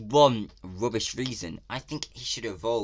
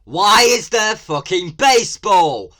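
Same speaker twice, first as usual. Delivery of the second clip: much louder and higher, slow, furious; outraged shout; 10 semitones higher, 15 dB louder, slower than usual. Real, not acted. Vlogger yelling at the trailer about baseball. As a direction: yell the question in disbelief.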